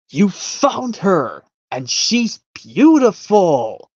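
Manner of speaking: slow and high, wide-eyed joy; excited Thomas. Retold or imitated. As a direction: gasp it out as if seeing treasure. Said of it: "Thomas exclaims out in excitement".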